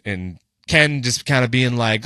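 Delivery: ordinary talk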